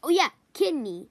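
normal talking voice

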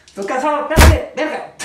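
high, loud breathless outburst; exhausted yell. Real, not acted. Worn-out player yelling mid-fight, high and loud. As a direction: shout it out of breath.

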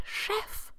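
chef with a soft whispered sh. Shown as a demonstration. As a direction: say chef, sh not ch. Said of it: "That sounds sh".

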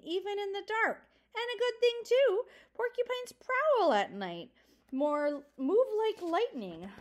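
put-on father getting carried away warning the children; rising agitation. A narrator's reading. Father grows worked up.

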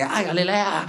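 high, soft mock fan squeal; small high fawning voice; gushing fan. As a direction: imitate an adoring fan calling out. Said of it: "porque la gente así que".

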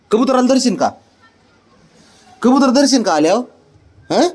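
high teasing question. Real, not acted.

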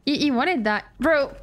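ordinary talk, nothing marked

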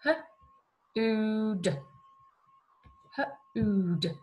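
H-ood split into h and a short oo. Shown as a demonstration. Teacher sounds out H-ood for children to blend. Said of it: "the short oo sound".

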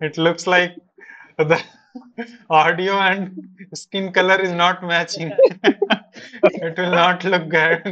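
retelling wife's teasing through laughter; laughing. Retold or imitated. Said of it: "she told".

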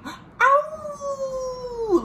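high, short mock howl "Ow!"; wolf howl. Reader howling like the red wolf for the child.

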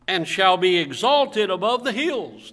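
loud, slow, declaimed Bible reading; preacher reading out scripture, drawn out and strong; scripture reading aloud. Real, not acted. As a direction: proclaim the verse to the congregation. Reads scripture to congregation.

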